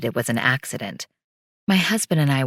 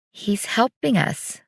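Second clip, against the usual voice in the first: hesitant, slower and higher, unsure; tentative; 7 semitones higher, slower than usual. A narrator's reading. Daughter unsure, telling her mother, said tentatively. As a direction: offer it without conviction. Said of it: "she said tentatively".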